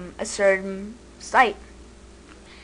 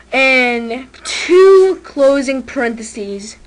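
"two" slow and loud, each word drawn out; stress on two; 3 semitones higher, 11 dB louder, much slower than usual. Shown as a demonstration. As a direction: lean hard on "two closing parentheses". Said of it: "not just one".

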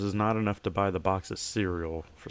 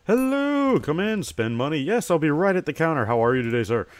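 high bright sing-song shop greeting, louder; cheery shopkeeper; 6 semitones higher, 6 dB louder than usual. Acted. Shopkeeper hailing a customer entering the store, cheery and loud. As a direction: welcome customers like an eager shopkeeper.

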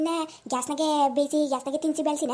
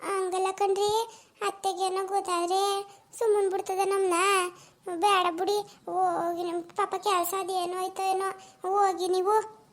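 put-on 1 shy young wife; 2 semitones higher than usual. Young wife pleading with husband.

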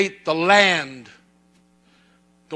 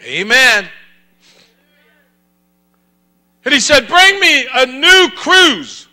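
loud, high, emphatic revival preaching shout; full-force preaching; 8 semitones higher, 12 dB louder than usual. Real, not acted. Preacher urging the church toward revival, full-force shout. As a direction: preach it out in forceful bursts with long pauses.

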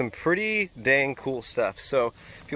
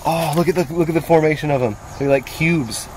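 excited; 4 semitones higher, 4 dB louder than usual. Real, not acted. Admiring the crystal up close.

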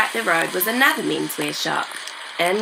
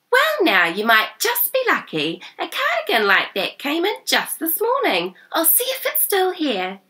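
put-on high, eager young woman voice; a bright helpful shop girl; cheery shop assistant; 10 semitones higher than usual. A narrator's reading.

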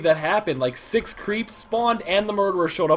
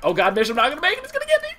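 high, fast desperate pleading; being chased; 6 semitones higher than usual. Real, not acted. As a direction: cry for help as the killer closes. Chased, about to die.

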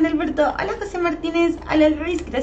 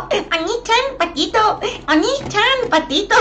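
sing-song name; 5 semitones higher than usual. Real, not acted. Joking about a chat name.

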